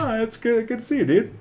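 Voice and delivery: high, bubbly hello; squeaky friendly greeting to a pal; cheery greeting voice. Pretend.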